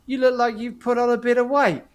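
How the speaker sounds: put-on old cellmate ribbing him about his weight; teasing friend. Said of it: "He says".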